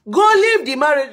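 high, loud insistence; mother yelling at daughter to leave; mother's urgent shout. Retold or imitated. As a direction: bark it like a frantic mother.

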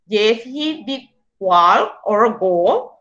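slow, spelled-out model sentence; slow example read. Shown as a demonstration. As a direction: read each word slowly for the class. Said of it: "We have short answer".

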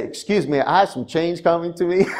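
put-on a light Southern US accent, half laughing at himself. Pastor retelling a Mississippi gas station visit, light Southern accent. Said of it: "Why am I talking like this?".

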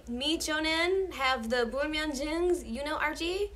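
deliberately bad American accent in Korean. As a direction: exaggerate an American learner's Korean pronunciation. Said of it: "which is, like, American accent".

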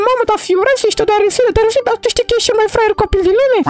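put-on quick, teasing girl voice, half exasperated; a girl teasing her silly friend; high girl character voice. Mara teases Darusel after the birthday surprise, high teasing voice.

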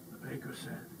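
ordinary delivery, nothing marked

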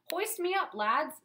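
muffled cry from inside a helmet. A narrator's reading. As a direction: call out through a closed visor. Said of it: "Sir Mort cried in a muffled voice".

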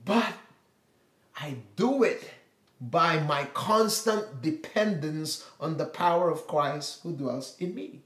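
ordinary talk

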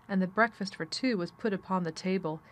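ordinary delivery

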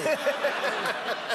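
high, hearty laugh at own story. Real, not acted.

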